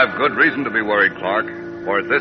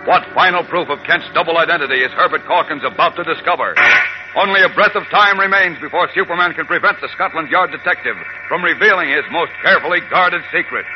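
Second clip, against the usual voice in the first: high, booming cliffhanger announcer; old radio announcer; 8 semitones higher, 4 dB louder than usual. Acted. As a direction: hype tomorrow's episode, pitch raised. Cliffhanger outro.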